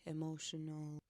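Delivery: ordinary talk, nothing marked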